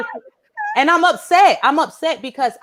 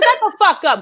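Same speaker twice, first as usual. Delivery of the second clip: high-pitched mock snap; irritated cut-off; 10 semitones higher than usual. Mother silencing the complaining girl, irritated. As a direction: cut her off sharply, pitch way up.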